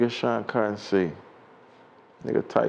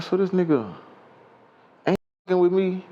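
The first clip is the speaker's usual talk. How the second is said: high-pitched incredulous question; retold disbelief; 10 semitones higher than usual. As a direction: replay your disbelief at being snubbed. Retells his disbelief at being snubbed.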